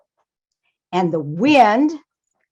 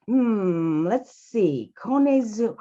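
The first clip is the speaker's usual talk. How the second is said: pondering; 4 dB quieter, slower than usual. Acted. Father Mouse ponders the name.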